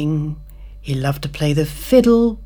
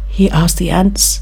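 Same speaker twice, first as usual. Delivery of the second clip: usual speaking voice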